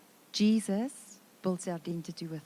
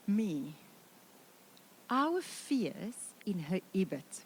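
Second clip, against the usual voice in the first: ordinary delivery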